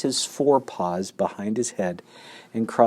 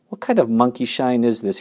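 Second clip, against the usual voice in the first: ordinary delivery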